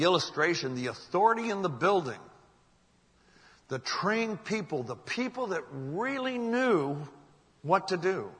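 preacher building with deliberate pauses; emphatic preaching. Real, not acted. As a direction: drive the point home, pitch climbing on key words. Preacher drives home the lesson.

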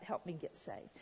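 low, soft retold plea; hushed, understated begging. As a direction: quietly imitate someone begging for help. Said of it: "begging Matt".

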